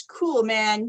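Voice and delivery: plain reading voice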